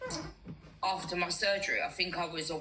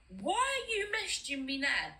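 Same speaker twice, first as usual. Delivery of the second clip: higher-pitched, exasperated protest; retold disbelief, pitched up; 6 semitones higher than usual. Woman recalling her reaction to her ex's message, exasperated.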